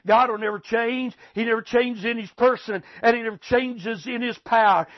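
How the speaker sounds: ordinary delivery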